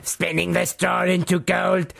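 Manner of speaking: high, drawn-out gnome voice; slow, high storybook gnome. Acted. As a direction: stretch each word in a high imp voice. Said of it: "shall I carry on doing the Rumpelstil".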